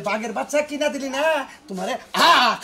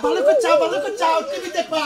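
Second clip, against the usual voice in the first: high-pitched scolding; 10 semitones higher than usual. Acted. Father telling off son who watched TV, high-pitched scolding. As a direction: voice jumps far up in pitch.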